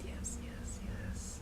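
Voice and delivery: whispered yes yes in rapture; hushed ecstatic whisper; awed whisper. Real, not acted. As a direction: whisper it, overwhelmed by how pretty it is. Swooning over highlight, whispering.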